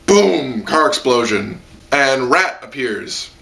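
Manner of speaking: ordinary talk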